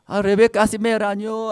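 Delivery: loud, high mock wail; crying child. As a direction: imitate a little girl wailing her complaint. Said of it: "así lloraba".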